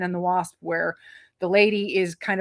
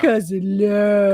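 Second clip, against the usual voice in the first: louder, drawn-out gushing on "love"; mock lovey-dovey; 6 dB louder, slower than usual. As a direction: ham up the mushy romance, full voice. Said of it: "I'll never do that on camera again".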